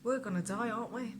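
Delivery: ordinary delivery, nothing marked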